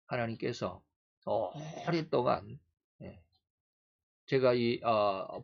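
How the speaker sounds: normal talking voice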